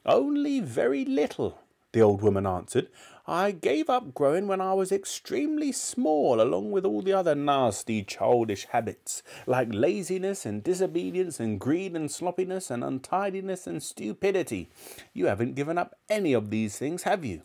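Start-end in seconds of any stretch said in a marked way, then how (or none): 0.0-1.6 put-on high-pitched old-woman voice; haughty grandmother. A narrator's reading. Grandmother answering the boy. 3.3-17.4 put-on high old-woman voice, lecturing; nasty grandmother. A narrator's reading.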